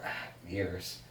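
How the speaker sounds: normal talking voice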